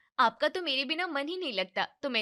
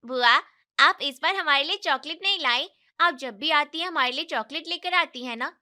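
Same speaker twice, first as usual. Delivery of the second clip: put-on high whiny kid asking aunt; pleading little nephew; child voice; 4 semitones higher, 4 dB louder than usual. Kids pestering aunt for chocolate.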